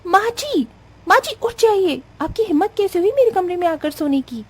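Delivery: high-pitched, outraged outburst; furious daughter-in-law. Acted. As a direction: scold her for intruding, voice shooting up. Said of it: "गुस्से के मारे आग बबूला हो जाती है". (आग बबूला बहू की ऊँची चीख़ती फटकार)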